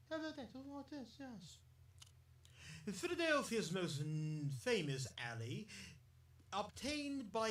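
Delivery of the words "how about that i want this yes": put-on English accent. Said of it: "do a bit of an English accent".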